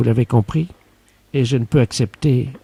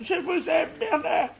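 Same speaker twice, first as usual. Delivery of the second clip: hammed-up lovestruck; 5 semitones higher, faster than usual. Secretary confesses love to her boss in a very high hammed-up falsetto. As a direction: pour out a mock love confession.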